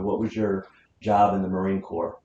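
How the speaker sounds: ordinary delivery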